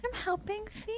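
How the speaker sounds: put-on a small boy's proud little answer; tiny child voice. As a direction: toddler telling mom he's helping, high and hushed. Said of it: "And he goes".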